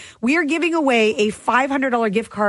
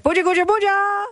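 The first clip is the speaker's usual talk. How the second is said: high singsong dad call; dad's teasing nickname; 8 semitones higher than usual. Retold or imitated. Dad teasing his daughter with a rhyme, singsong. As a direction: sing out the rhyming nickname. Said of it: "He'd be like".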